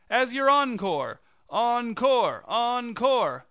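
high, loud demand turning into a chant; shouted. A narrator's reading. Director's husband insisting on an encore, shouted. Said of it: "shouted the director's husband".

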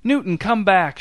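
high, loud call after him. A narrator's reading. Tammy calling after Newton down the aisle, loud call. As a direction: shout to stop him leaving. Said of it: "shouted Tammy".

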